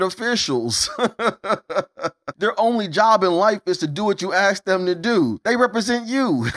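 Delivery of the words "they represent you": laughing through the words, pitch up; can't keep the laugh out of it. Real, not acted. Lecturing viewers about politicians while laughing through it. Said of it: "I'm sorry if I'm laughing so hard".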